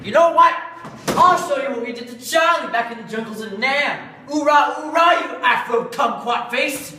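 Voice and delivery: screaming drill sergeant rant; loud gung-ho soldier. Acted. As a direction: bellow it like a crazed Marine. Partner explodes in a war rant.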